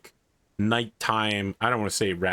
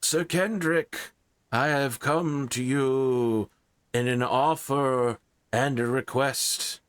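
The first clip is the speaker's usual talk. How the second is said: king voice; 4 semitones higher than usual. Acted. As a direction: speak like a stately king summoning a knight. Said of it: "the King of Somerset".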